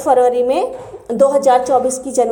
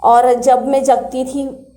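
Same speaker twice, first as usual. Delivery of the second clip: ordinary talk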